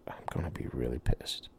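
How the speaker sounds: breathy whisper of annoyance; half-whispered irritated aside. Retold or imitated. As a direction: whisper it like a secret grumble. Private thought while waiting.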